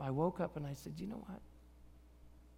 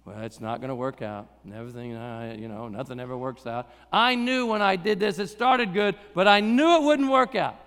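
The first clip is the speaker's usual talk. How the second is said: defeated grumble; 8 semitones lower than usual. Retold or imitated. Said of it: "you get used to it".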